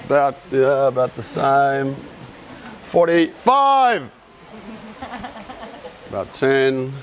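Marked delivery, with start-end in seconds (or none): 2.8-4.1 slow, raised call to the room; drawn-out, higher-pitched roll call. Real, not acted. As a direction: stretch the number out for the crowd. Calling out counts and numbers.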